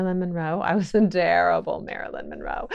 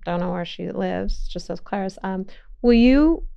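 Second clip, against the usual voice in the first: ordinary delivery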